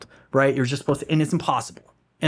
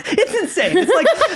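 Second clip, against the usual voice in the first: loud laughing, much higher voice; 3 dB louder than usual. Real, not acted. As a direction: laugh through the disbelief. Laughing about waking at four.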